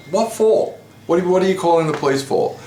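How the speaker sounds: disbelieving retold protest, high and fast; indignant disbelief. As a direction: pitch shoots way up on "What for?", then a fast follow-up. Retold indignant reply to threat.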